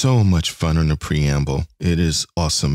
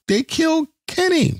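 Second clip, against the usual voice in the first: very high squeaky they killed Kenny; mock South Park cry. Host jokes about the transporter chief, squealing South Park line. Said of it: "almost sounds like".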